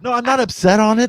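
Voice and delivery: high, heated denial; defensive protest. Real, not acted. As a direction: protest with a laugh. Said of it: "He's heated".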